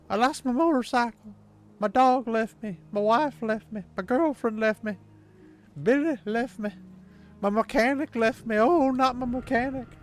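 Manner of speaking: high, twangy country lament; mock country twang. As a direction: whine it like a hammed-up twangy country song. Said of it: "I don't like twang. ... The majority of country's like".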